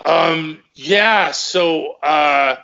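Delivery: halting fillers with long gaps; mock lost for words. Caught promoter calling about the money, imitated awkward stalling. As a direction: imitate someone stalling for an answer. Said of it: "he didn't even know what to say".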